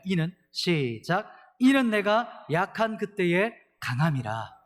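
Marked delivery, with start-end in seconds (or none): 1.6-4.6 slow, loud, declaiming recitation; proclaiming scripture. Real, not acted. Pastor leading the congregation in proclaiming the verse. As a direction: proclaim it together, slowly. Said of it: "한 번 더 읽어볼까요".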